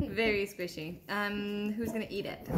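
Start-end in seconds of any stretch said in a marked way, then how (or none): 0.0-1.0 laughing. Real, not acted. Laughing at squishy strawberry.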